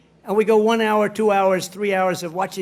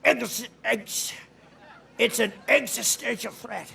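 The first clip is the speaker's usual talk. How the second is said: mocking a halting old man, stumbling on the word; imitating Biden; 5 dB quieter, slower than usual. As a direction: stammer it out like a stuck old politician. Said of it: "Biden had always used to say".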